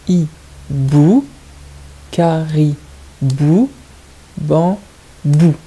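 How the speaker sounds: "Hibou", "caribou", "bambou" stretched, with "bou" set apart; syllable by syllable. Shown as a demonstration. Teacher showing the shared ending, words split into syllables. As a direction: hold each syllable, pause before the final "bou". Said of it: "Écoutez bien".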